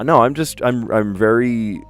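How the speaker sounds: ordinary talk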